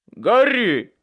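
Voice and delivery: loud, high cry of the name; shouted warning. A narrator's reading. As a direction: yell to warn your friend. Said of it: "крикнул в ответ Майкл". (громко крикнуть, предупреждая)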